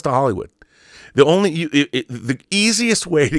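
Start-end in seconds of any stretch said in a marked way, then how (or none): none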